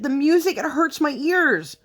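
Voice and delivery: put-on high, small child's voice, broken down; tearful little girl. Mom retelling her daughter's tearful complaint in a high child voice. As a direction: sound like a crying four-year-old. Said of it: "finally she just broke down".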